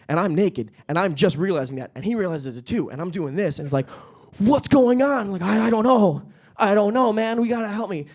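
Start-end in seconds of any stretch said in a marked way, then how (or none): 4.4-5.3 high, louder panicked question; mock panic. Naked man asks his dog, high mock panic. 5.4-8.1 high, helpless mock panic. Naked owner pleads with his dog, high mock panic.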